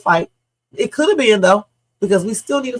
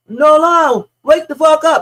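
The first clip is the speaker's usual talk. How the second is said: loud, high cry to wake someone; imitating a frightened friend's shout; retold panicked shout; 7 semitones higher, 8 dB louder than usual. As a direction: yell it at someone who won't come to. Quoting shouts from the audio.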